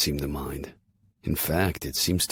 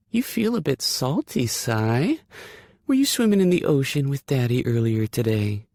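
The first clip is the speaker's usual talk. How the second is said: put-on dog baby talk; 10 semitones higher, 5 dB louder than usual. Cooing to the dog.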